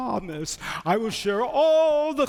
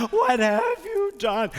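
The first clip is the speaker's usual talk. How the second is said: higher, slower anguished wail; hammed-up rat wailing, much higher and slower; anguished cry; 9 semitones higher than usual. Speared rat cries again.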